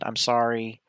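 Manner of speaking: usual speaking voice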